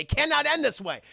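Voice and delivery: high, emphatic denial; hammed-up refusal to accept it; mock desperate protest. Max protests the ending.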